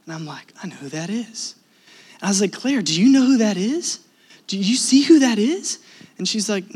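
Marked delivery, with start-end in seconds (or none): 0.6-1.5 hushed recognition. Retold or imitated. Husband spots a celebrity in the crowd, hushed recognition. 2.5-5.8 high, urgent questioning; acted starstruck questions, pitched up; excited. Husband alerts wife to a celebrity, excited.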